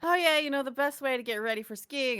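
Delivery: high, loud announcement to nobody in particular; saying it out loud. Acted. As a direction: proclaim it loudly to the air. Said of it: "says it out loud".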